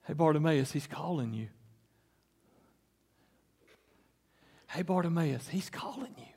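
low, soft near-whisper; hushed. Acted. Bystanders tell blind Bartimaeus he is called, hushed. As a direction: whisper it with long pauses. Voicing those who tell the beggar.

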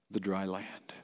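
normal talking voice

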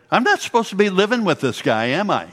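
a sheepish question that dissolves into a laugh; Beth, laughing sheepishly. Retold or imitated. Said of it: "she would say".

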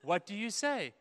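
mock parent coaxing a child; sing-song parent asking for manners; parent prompting. Parent coaching a child to say thanks.

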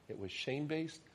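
usual speaking voice

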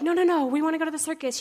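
high, loud, hurried pleading; urgent mock whine of protest; upset kids protesting. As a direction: imitate kids protesting in a rush. Upset boys protest leaving.

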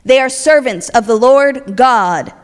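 loud, slow announcement; hammed-up shouting possessed woman; screaming woman. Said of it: "the woman starts screaming".

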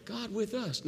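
with and us lifted high; high, emphatic. Shown as a demonstration. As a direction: call it out high and bright to the room. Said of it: "Do it again and go like this".

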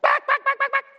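high squeaky rewind chatter; rewind noise. Pretend. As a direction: mimic a tape rewinding. Rewinding slides, mimics rewind.

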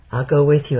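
usual speaking voice

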